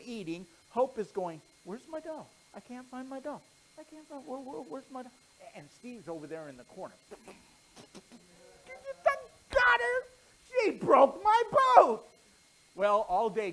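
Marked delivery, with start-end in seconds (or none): 1.7-5.1 put-on fretting little girl. Hope searching for her doll. 7.9-12.1 high, loud, slow, crying-out taunt; gloating boy. Acted. Steve gloats in the corner.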